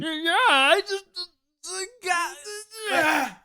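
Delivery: high, strained, halting comic voice; Bobcat Goldthwait impression. Shown as a demonstration. Podcaster shows off his impression for co-hosts, high strangled voice. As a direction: squeeze out stammered words way up high. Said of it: "you have a pretty good impression".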